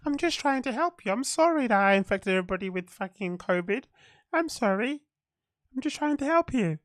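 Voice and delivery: high, soft, hammed-up innocent tone; soft Bill Gates voice. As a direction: play the gentle peacemaker, mockingly. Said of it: "like in his Bill Gates, Bill Gates voice".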